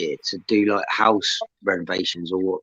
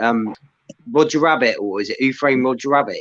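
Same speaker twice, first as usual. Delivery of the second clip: ordinary talk, nothing marked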